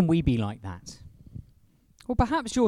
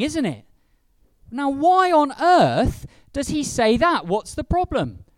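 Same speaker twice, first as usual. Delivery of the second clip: ordinary talk, nothing marked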